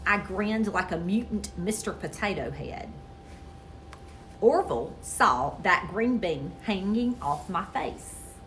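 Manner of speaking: usual speaking voice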